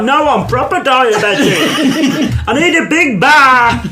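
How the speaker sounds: high, drawn-out comic demand; hammed-up needy plea; mock desperation. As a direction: wail it slowly, half laughing. Mock diabetic demand, acted out.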